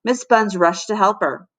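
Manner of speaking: ordinary delivery, nothing marked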